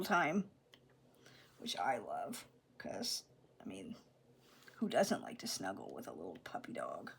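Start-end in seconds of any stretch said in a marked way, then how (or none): 1.6-7.1 soft whispered aside to the viewer. Real, not acted. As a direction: let it slip out as a private whisper. Fond whispered aside about snuggling.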